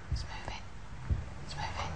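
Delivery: breathless whisper; awed whisper. Real, not acted. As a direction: whisper in awe as the glass moves. Glass moves at seance.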